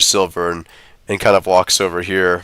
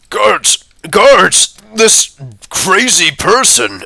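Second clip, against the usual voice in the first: shouting for guards; 10 semitones higher, much slower than usual. Acted. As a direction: yell for help and point him out. NPC yelling for guards.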